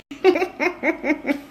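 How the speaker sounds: high-pitched stuttering giggle. Real, not acted. Host amused by dowry bids of millions, high giggle. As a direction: giggle high in a string of short bursts.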